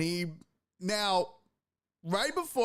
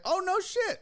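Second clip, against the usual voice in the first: surprised. Retold or imitated. Surprised by the news.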